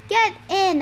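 loud, high command; yell. A narrator's reading. Boy ordering friends into the lifeboat, yelling. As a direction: shout the order urgently. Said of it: "I yelled".

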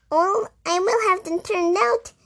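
cross mouse. A narrator's reading. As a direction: huff and threaten, high. Mouse threatens the bees.

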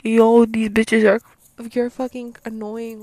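loud, slow mock complaint, words dragged out; loud exasperated outburst. Bystander complaining about the noisy friends, loud and drawn out. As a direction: shout it like a fed-up onlooker. Voicing onlookers exasperated by the group.

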